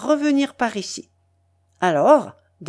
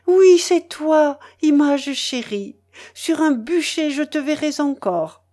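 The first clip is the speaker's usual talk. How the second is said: delirious rapture; 6 semitones higher than usual. A narrator's reading. Mélanie raving about her beloved in delirium. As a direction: lose herself in ecstatic longing. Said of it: "comme en délire".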